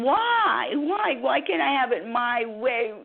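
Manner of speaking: high whiny pout, rising to a squeal on the first why; childish tantrum of complaints. Acted. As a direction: sulk like a spoiled child demanding her way. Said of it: "I have to do my pouting".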